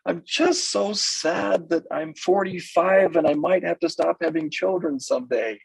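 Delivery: hammed-up sorrow over having to stop having babies; mock lament. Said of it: "And she's all".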